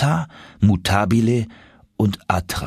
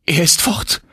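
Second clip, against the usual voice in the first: calling out; 9 semitones higher than usual. A narrator's reading. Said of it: "rief Martha".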